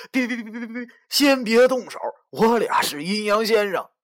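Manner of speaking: high, stammering plea for mercy; panicked pleading. Acted. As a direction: beg him to stop, tripping over the words.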